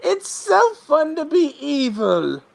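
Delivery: high, slow villain voice; evil banker. Acted. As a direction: relish it as a hammed-up baddie. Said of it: "if he talked like that".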